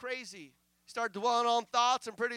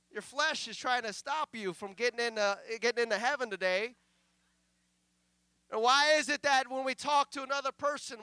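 ordinary talk, nothing marked

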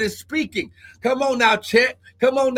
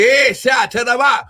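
tongues; 3 semitones higher, 3 dB louder than usual. Real, not acted. Speaking in tongues mid-sermon.

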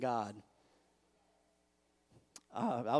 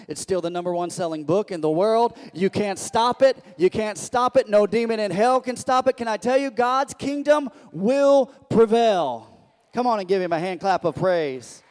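loud, rising preacher's proclamation; full-force preaching; 4 semitones higher, 10 dB louder than usual. Real, not acted. Preacher rallying the congregation to applaud, full-force preaching. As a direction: preach it with full-throated conviction.

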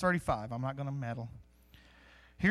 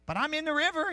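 much higher, a hint of an indignant protester; defensive believer; 9 semitones higher, 4 dB louder than usual. Stuck believer insists to the preacher, higher and defensive. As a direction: protest it like someone caught out.

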